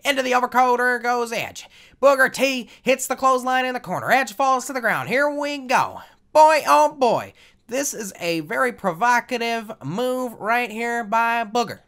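loud, high wrestling play-by-play; announcer voice. Pretend. Gamer calling his own match, big high announcer voice. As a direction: call the match like a ringside announcer. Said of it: "That's my announcer voice right there".

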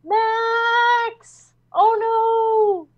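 high mock dismay cry; mock alarm. Watching Max in danger, high mock dismay. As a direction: cry out as if he's doomed. Character in peril.

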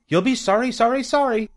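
very high goose taunt; goose calling warning. A narrator's reading. The goose warns Wilbur as he leaves, calling high. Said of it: "called the goose".

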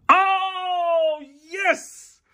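very high, thrilled cry of joy; excited squeal. Real, not acted. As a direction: yelp it out in delight. Pulls a card he wanted.

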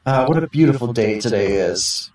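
usual speaking voice

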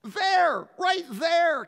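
high, loud insisting; insistent pointing. Retold or imitated. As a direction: jab at it with your voice. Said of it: "she points".

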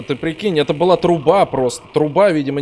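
ordinary delivery

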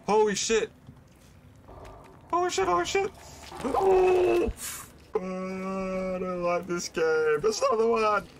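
panicked high-pitched shrieks; screaming in real fright; scared horror game streamer. Real, not acted.

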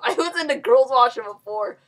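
usual speaking voice

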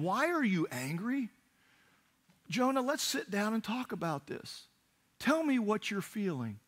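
high-pitched, gentle coaxing; coaxing parent. Acted. God as a daddy inviting Jonah to talk, coaxing. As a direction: draw him out tenderly, pitched well up.

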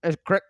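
"crick" said with a short i, rhyming with "stick"; "crick" for creek, Pennsylvania style; local pronunciation. Shown as a demonstration. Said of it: "And you call it crick".

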